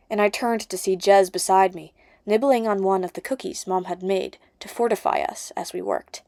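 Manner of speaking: ordinary delivery, nothing marked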